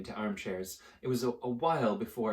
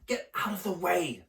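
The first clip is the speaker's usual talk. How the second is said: snapping; 8 semitones higher than usual. Acted. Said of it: "snapped Ron".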